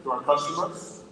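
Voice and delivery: ordinary delivery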